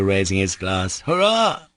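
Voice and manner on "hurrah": cheering. Acted. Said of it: "cried Mitya".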